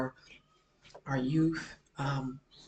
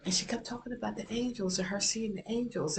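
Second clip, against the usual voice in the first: ordinary delivery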